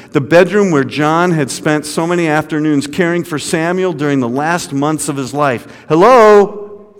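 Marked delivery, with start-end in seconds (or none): 5.9-7.0 high, loud call through the house; shouting. A narrator's reading. Chaplain searching the house for the family, shouting. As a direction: shout to be heard downstairs. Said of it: "John shouted".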